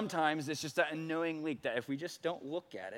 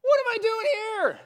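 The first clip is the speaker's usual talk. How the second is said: high, loud disbelieving wail; high mock-exasperated outburst; incredulous cry; 8 dB louder than usual. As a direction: squeal at your own foolishness. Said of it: "I'm like".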